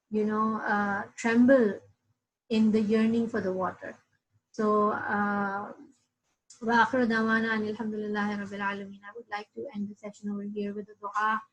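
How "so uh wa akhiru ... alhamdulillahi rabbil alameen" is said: ordinary delivery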